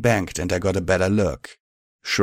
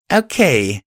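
calling out; 5 semitones higher, 5 dB louder than usual. A narrator's reading. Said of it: "I called out".

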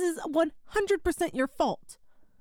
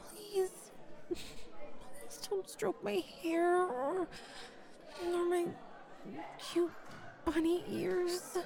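hushed pleading; 9 dB quieter, slower than usual. Acted. Pleading softly in public.

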